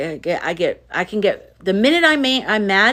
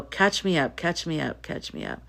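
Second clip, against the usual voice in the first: each repeat softer until a whisper; fading to whisper; 8 dB quieter than usual. Real, not acted. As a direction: trail off to a whisper.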